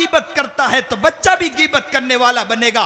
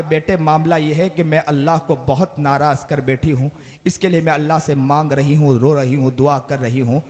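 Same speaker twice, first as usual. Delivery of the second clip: grieving mother; 8 semitones lower than usual. Retold or imitated. Weeping mother voiced low.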